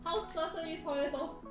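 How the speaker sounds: usual speaking voice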